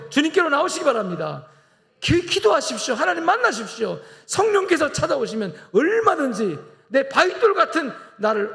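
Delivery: high, loud, ringing pulpit cry; impassioned sermon climax; fervent preaching. Acted. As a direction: lift the congregation with a rising preacher's call. Preaching at full force.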